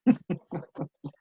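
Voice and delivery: high, light chuckle; real amused laugh after own joke. Real, not acted.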